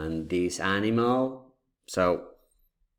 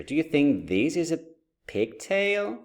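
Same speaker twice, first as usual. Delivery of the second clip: playful surprised question; 7 semitones higher than usual. Shown as a demonstration. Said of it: "teacher quizzing playfully".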